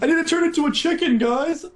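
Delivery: high, fast whiny impression; mock the streamer's whine; imitating Phil. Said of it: "He'd be like".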